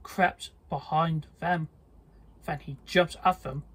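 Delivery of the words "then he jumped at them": plain reading voice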